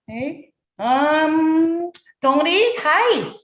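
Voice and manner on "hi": ordinary talk, nothing marked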